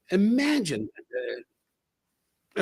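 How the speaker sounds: Imagine that stretched very slowly and softly; a slow, quiet wondering aside; drawn-out marvel. Shown as a demonstration. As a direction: savour every syllable, slow and hushed. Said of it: "Imagine that. (repeated three ways)".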